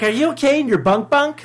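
high, laughing mock-mom voice; teasing a friend in a silly high mom voice. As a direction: squeak it like a fussing mother. Mocking mom's question.

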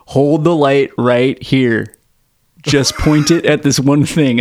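slow, spelled-out instructions; slow, deliberate dad voice; patient dad. Retold or imitated. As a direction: lay it out slowly like a tired father. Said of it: "My dad would be like".

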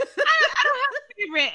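very high mock denial through laughter; laughing, high-pitched put-on denial; laughing imitation. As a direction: imitate the mother, cracking up in a high voice. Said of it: "she's like".

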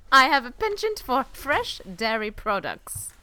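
laughing through a hammed-up German accent; a weak, giggly German accent as Hans Landa; mock German accent while laughing; bad German accent. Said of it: "This is a terrible accent".

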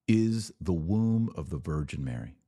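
ordinary delivery

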